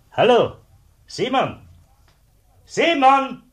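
high, loud call across the yard. Acted. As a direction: shout his name to fetch him from inside. Zöllner ruft Kollegen herbei. (laut nach Simon rufen)

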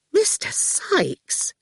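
high, very slow and drawn-out reproach; reproachfully. A narrator's reading. Fifi reproaches Quentin for agreeing to the words, drawn-out reproach. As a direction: stretch the name out as a gentle scolding. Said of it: "Fifi said reproachfully".

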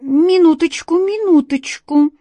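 high loud hurried cat cry; exclaiming cat. A narrator's reading. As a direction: cry out to stop the genie. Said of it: "воскликнул он".

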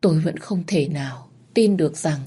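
ordinary delivery, nothing marked

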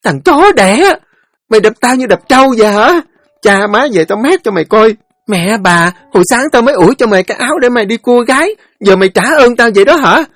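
very high, loud screaming curses; shrieked tirade. A narrator's reading. Sister hit by her brother, shrieking furious threats. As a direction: yell like a pig being stuck. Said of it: "nghe Lanh trú lên".

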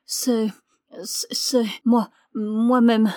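slow, halting, strained confession; faltering injured man, broken by pauses; weak but distinct. A narrator's reading. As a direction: struggle out each word as if wounded. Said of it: "d'une voix faible, mais encore très distincte".

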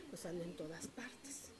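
conspiratorial whisper; a hushed gossip aside; gossipy whisper. Real, not acted. Admits to being a gossip, aside.

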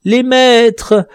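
high, loud, slow desperate begging; terrified dwarf begging for mercy, high and loud; pleading in terror. Acted. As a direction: beg for your life from the edge. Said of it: "suppliante et douloureuse".

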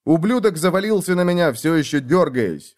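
plain reading voice

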